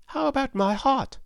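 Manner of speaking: high-pitched character voice; pleading Tin Woodman. A narrator's reading. Tin Woodman asks Oz for a heart, high and pleading.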